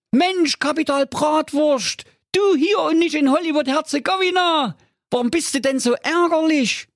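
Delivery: high goofy cartoon voice; Holger Honk's voice. A narrator's reading. Honk thinks about flowers for his mother, high goofy voice. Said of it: "der Honk sagte zu ihm".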